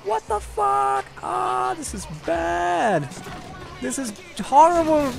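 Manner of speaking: high, hammed-up panicked yelp; mock panic. Vlogger replays his panic at seeing her outfit, high and shrill. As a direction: squeal in fake horror at the clothes. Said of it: "I'm like".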